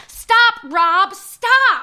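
loud desperate stop Rob stop; pleading shout. Acted. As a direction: yell to make him stop.

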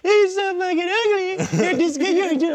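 put-on high falsetto baby talk; sweet dog-insult voice. Dad teasing his dogs, sweet high cooing. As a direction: coo insults as if they were praise. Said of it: "talk shit to my dogs in a sweet".

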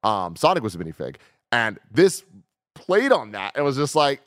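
usual speaking voice

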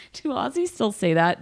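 usual speaking voice

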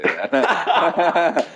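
laughing through the words, slow and high; giggling aside. Real, not acted. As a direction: let the laugh carry it. Laughing at the joke.

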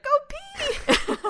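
put-on squeaky, very high toddler insistence; little toddler. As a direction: insist in a tiny child voice, amused.

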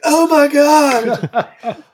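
very slow, high gush through a laugh; drawn-out gush. Retold or imitated. Guest imitates the singer seeing the beagle, slow high gush. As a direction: stretch it out, melting. Star gushing over the dog.

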